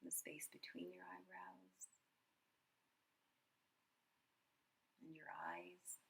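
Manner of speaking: soft, unhurried meditation voice with long pauses; guided meditation. Acted. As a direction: guide them gently through a body scan, quietly. Leads a body scan meditation.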